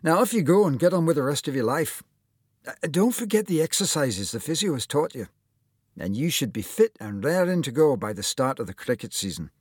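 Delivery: lifted, lively Scottish surgeon; Scottish accent. Acted. Surgeon dismissing his recovered patient, Scottish accent. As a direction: send him off in a Scottish lilt. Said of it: "with just a hint of a Scottish accent".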